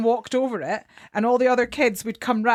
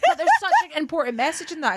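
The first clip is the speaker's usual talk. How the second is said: laughing; 9 semitones higher, faster than usual. Real, not acted. Laughs while speaking.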